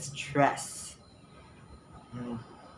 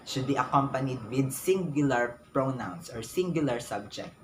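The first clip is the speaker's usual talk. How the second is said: ordinary talk, nothing marked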